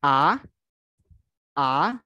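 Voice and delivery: "A" sliding up into a rise; second tone. Shown as a demonstration. Teacher shows the rising second tone. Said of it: "naik punya".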